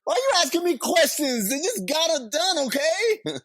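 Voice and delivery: high, laughing defensive squeak; mock defensive whine. Repeats the defensive character bit.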